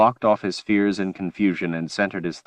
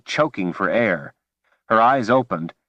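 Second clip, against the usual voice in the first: ordinary delivery, nothing marked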